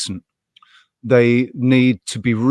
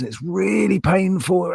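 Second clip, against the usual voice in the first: relishing the gross detail; excited outburst; 8 semitones higher than usual. Real, not acted. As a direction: voice jumps up high on "painful". Gleeful story of squeezing spots.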